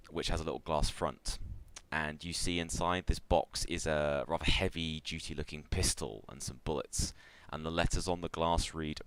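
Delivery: usual speaking voice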